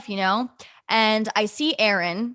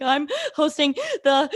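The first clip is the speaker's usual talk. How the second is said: mock high, gaspy hyperventilating; 7 semitones higher than usual. Telling her parents the hosting news, imitated hyperventilation. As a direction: act out being too overcome to get words out. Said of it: "like, hyperventilating".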